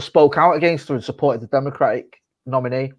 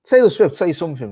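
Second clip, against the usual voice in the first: calling out; 6 semitones higher, 3 dB louder than usual. Acted. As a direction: call her name out, louder and higher. Calling out a celebrity.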